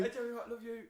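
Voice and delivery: high, loud, gushing fan voice; teasing his mate's fangirl moment, loud and high; mock starstruck fan. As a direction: gush at your idol, overexcited. Mimicking friend's nervous greeting.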